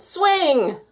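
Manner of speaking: high, loud single yell; crowd yelling. A narrator's reading. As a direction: scream it with the whole crowd. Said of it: "the crowd yelled".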